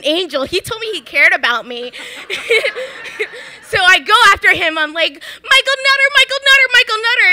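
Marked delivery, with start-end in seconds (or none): 5.1-7.3 calling a name across the street, much higher. Retold or imitated. Teen chasing the mayor outside City Hall, calling out high. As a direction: chase him down calling his name.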